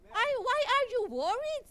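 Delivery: high, gentle chiding question; high voice of the Lord. Retold or imitated. The Lord asking Loida why she worries, in a high chiding voice. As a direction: ask it lightly high, like a loving parent. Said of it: "sabi niya".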